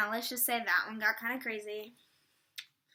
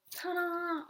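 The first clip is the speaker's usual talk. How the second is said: ta-da flourish; 5 semitones higher than usual. Real, not acted. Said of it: "I don't know why I just did that".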